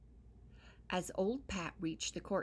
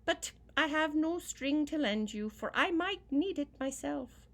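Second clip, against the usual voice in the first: put-on wicked boy; 10 semitones higher, 7 dB louder than usual. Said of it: "he said to old Pat".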